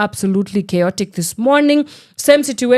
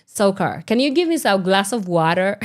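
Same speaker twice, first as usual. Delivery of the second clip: mock American accent with pronounced r. Radio host redoing her botched word, mock American accent. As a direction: put on an exaggerated American r. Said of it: "that's not an American accent".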